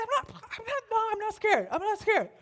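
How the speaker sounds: high, loud, flustered denial; defensive protest. Retold or imitated. Babysitter denying fear to a child, defensive. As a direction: protest rapidly, pitch jumping up.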